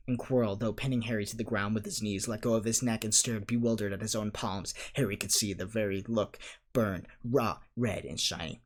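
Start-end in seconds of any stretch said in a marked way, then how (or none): none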